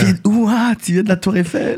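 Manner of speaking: high, gushing put-on voice; mock starstruck admirer. Girl swoons over a visitor from France, mock-starstruck. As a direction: coo in exaggerated awe at the foreigner. Voices girls impressed by a Frenchman.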